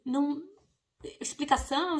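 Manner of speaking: normal talking voice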